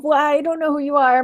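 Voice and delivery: ordinary talk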